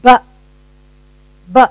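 "B" as a short, punched "buh"; letter sound. Shown as a demonstration. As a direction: sound out "B" as in bat, not the letter name. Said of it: "What is the sound?".

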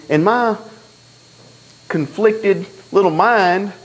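ordinary talk, nothing marked

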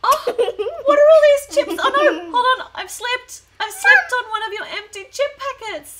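high, loud mock panic after a fall; hammed-up shock at slipping; pretend alarm. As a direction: mum doll calling out after slipping on chip packets. Mum doll slips on packets.

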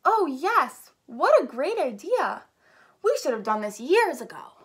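high, loud, swooping enthusiasm; wave of excitement. Acted. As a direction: gush like a thrilled crowd. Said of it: "A wave of excitement swept".

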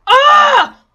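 high loud yell of real pain; sudden loud cry of pain. Real, not acted. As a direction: yell as it comes off. Taking pegs off.

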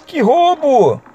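high outraged que roubo; indignant cry. Real, not acted. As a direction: protest the unfair hit. Game cheated again.

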